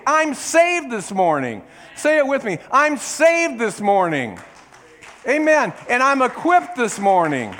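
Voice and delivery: loud, high fervent preaching; full-force sermon peak. Acted. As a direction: lift the congregation up.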